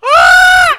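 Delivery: loud, high open "Ah!"; sudden ringing outburst; open-throated demonstration cry. Acted. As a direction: belt out a wide-open vowel in admiration.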